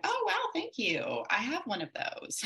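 high, breezy mock thank-you; mock naive thanks. Mom to counselor, in high breezy mock thanks. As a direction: sing it out, oblivious to the hint. Retelling own reply to the book.

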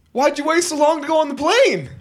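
high mock-drunk holler; drunk guy. Drunk traveler calls to a passenger at the gate, high mock holler. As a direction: imitate a drunk heckling across the room. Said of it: "Guy was obviously intoxicated".

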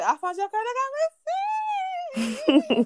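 high, slow, singing-ish diva voice; hammy Whitney with a laugh; bad [name] impression. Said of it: "That was terrible".

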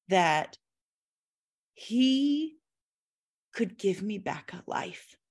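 usual speaking voice

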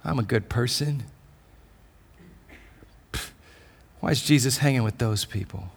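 a soft, quick, self-righteous grumble; a lightly voiced, muttering Pharisee; grumbling Pharisee. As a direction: grumble it under your breath like a smug onlooker. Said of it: "sitting outside grumbling".

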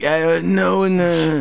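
mock the one voice he gives everyone; high, lightly put-on impression voice; same voice for everybody. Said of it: "you have the same voice for everybody".

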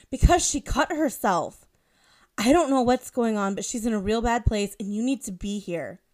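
loud, urgent phone plea. A narrator's reading. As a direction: press hard, she's in danger. Friend on phone in crisis.

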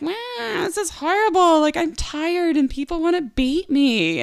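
hammed-up poor-me moan; mock whining. Retelling her complaint to Christy, high mock whine. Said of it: "wambulancing it".

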